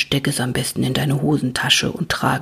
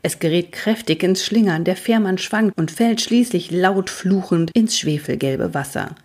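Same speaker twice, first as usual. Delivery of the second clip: usual speaking voice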